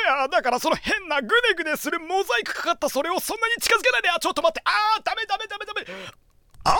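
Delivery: high, panicked pleading voice; high squealing panic impression; imitating Hahadera. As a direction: beg him to keep the mosaic thing away, voice up high. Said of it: "ハハデラさんのセリフ".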